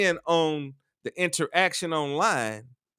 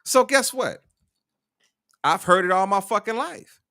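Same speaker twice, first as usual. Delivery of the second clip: ordinary delivery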